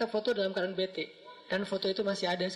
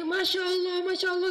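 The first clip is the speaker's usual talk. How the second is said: high, louder joyful cry; excited exclamation; 10 semitones higher, 3 dB louder than usual. Retold or imitated. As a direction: blurt it out, overjoyed and amazed. Driver overjoyed meeting the ustadz. (seruan gembira, suara tinggi dan keras)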